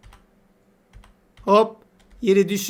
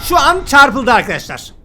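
louder, higher, slow excited call; thrilled gamer shouting out the moment; 5 semitones higher, 7 dB louder than usual. Real, not acted. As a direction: announce the zap with glee. Bird gets electrocuted on screen.

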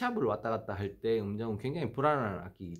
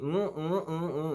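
sliding, unsteady hummed pitch; wobbly pitch hum; 7 semitones higher, slower than usual. Shown as a demonstration. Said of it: "응 이런 식으로 이제 굉장히 부정확하거든요".

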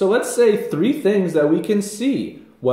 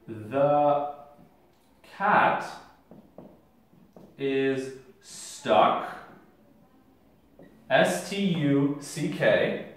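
slow dictation; 3 semitones lower, 6 dB quieter, slower than usual. Shown as a demonstration. Dictating while writing.

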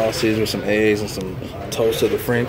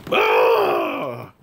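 startled yelp; 10 semitones lower than usual. Real, not acted. Sudden hiccup.

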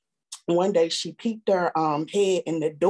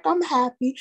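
insisting high; 7 semitones higher than usual. Retold or imitated. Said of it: "I was like".

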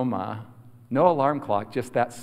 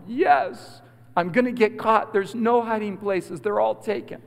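high-pitched urgent pleading; panicked child; 6 semitones higher than usual. Retold or imitated. Boy about to get caught tells grandma, panicky.

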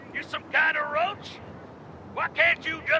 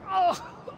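short groaning cry after the hit; pained cry; 6 semitones higher than usual. Acted. Villain reeling from Conway's blow, pained cry. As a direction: cry out as you reel.

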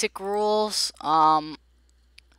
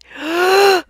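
sharp, high, loud gasp; 13 semitones higher, 12 dB louder than usual. Real, not acted. Player waits on the game's verdict, loud gasp. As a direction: suck in a huge breath of suspense. Awaiting game result, gasps.

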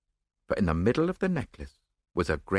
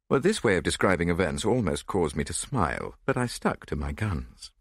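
ordinary delivery

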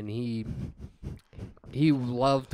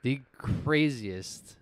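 ordinary talk, nothing marked

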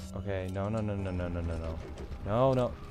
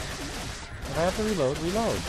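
high, raised-voice urging; exasperated yell; 9 semitones higher, 6 dB louder than usual. Real, not acted. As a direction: yell at the game in frustration. Yelling at gun to reload.

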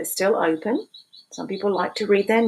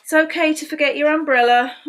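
bright, high storybook read; sing-song read-aloud for little kids; reading to children; 7 semitones higher, 5 dB louder than usual. A narrator's reading. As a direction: read it like a cheerful picture book. Reading picture book aloud.

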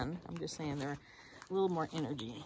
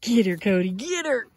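loud urging of the dog; cheering on; 3 semitones higher, 12 dB louder than usual. Real, not acted. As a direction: egg the dog on loudly. Cheering Cody on.

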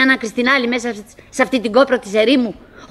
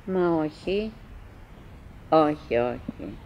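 muttered denial; 8 semitones lower, 10 dB quieter, slower than usual. Acted. Quietly denying to herself.

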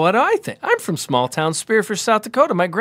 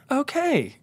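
stunned okay; 8 semitones higher, 3 dB quieter than usual. Retold or imitated. Stunned after cop's remark.

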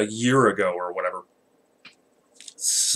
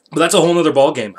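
fast, loud, higher cutoff; loud and quick; 5 semitones higher, 9 dB louder, much faster than usual. Real, not acted. Cutting off his own rant for viewers, loud and quick. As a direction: blurt it out to end the rant.

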